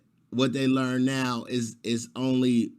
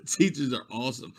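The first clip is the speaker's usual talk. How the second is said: ordinary delivery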